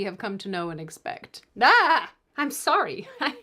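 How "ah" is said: sudden loud yelp, much higher; mock jump-scare yell. Host jump-scaring the audience with a loud yelp. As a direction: blurt a sharp shriek to startle. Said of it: "I did it again".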